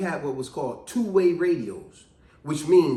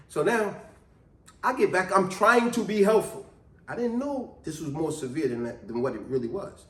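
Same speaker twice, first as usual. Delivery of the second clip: ordinary delivery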